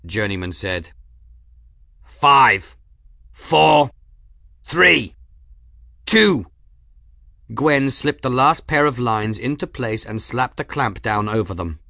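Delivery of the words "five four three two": loud, high countdown; shouted countdown. A narrator's reading. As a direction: count it down over the noise. Countdown shouted in engine room.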